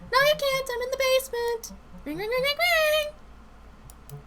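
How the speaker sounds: very high, silly denial; evasive [name]. Acted. Coworker pretending to be in the basement, high and sing-out. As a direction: insist in a high voice, then imitate ringing.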